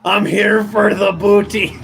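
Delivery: lightly voiced growly-free hammed pirate. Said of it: "they didn't talk like that".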